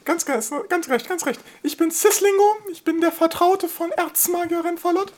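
very high lisping little dragon; squeaky, proud lisping dragon voice; slushy lisp. Acted. Dragon familiar Sislingo answers.

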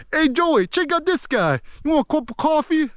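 high-pitched hammed-up East Coast accent; Boston-area East Coast accent. East Coast local calling Joey over, hammed-up Boston-area accent. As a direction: call out in broad East Coast twang. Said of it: "Kinda, like, talking like that".

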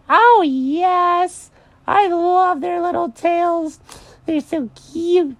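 very high, drawn-out bunny squeal; over-the-top high cartoon delight; gushing Mary the rabbit. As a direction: gush loudly over babies, stretched high. Mary gushes about her bunnies.

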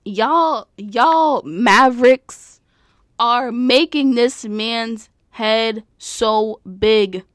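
loud, drawn-out, word by word; slow, loud telling-off; exasperated scolding. Real, not acted. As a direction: scold the fans slowly and loudly. Scolding the fans.

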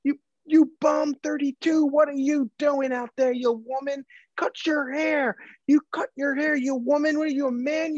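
put-on high, jeering old man; old heckler. Old fan heckling Jay Crowder, high mocking jeer. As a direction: sneer it like a cranky old fan. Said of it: "the whole game he just kept".

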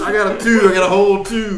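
outraged dice groan. Real, not acted. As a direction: complain loudly about the roll. Outraged at a bad dice roll.

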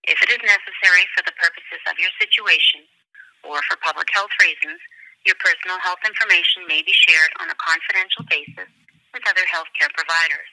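recorded automated phone message; prerecorded hold line announcement; phone menu voice. Real, not acted.

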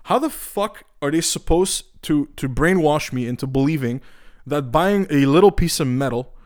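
normal talking voice

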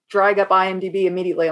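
normal talking voice

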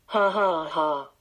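normal talking voice

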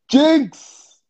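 high, loud call of the name; shouting a name. Real, not acted. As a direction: yell for him to come back. Calling a guest back into the stream.

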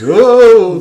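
sudden high loud whoa; startled whoa. Retold or imitated. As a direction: yelp it out in surprise. Acting her dropping the pearls.

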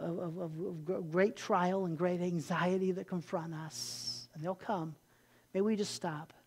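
soft, hushed prayer voice sinking to a whisper; closing prayer. Acted. Pastor prays over the congregation, soft and hushed. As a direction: pray quietly and intimately, trailing off.

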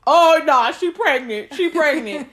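loud insistence. Retold or imitated. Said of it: "Phil was like".

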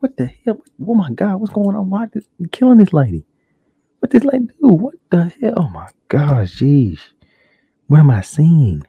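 high, loud shocked disbelief; shocked viewer. Retold or imitated. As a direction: play the stunned viewer watching a murder. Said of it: "You're like".

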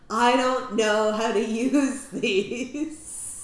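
slow, lightly voiced dopey Joey; Joey from Friends. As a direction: drawl it like clueless Joey. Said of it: "Joey from Friends being like".

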